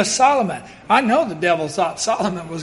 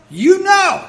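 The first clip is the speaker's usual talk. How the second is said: pleading cry; 10 semitones higher, 4 dB louder than usual. Retold or imitated. Hezekiah praying, repeated.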